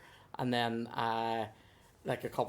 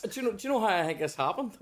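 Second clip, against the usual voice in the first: laughing; 7 semitones higher, 3 dB louder, faster than usual. Real, not acted. Laughing, fast.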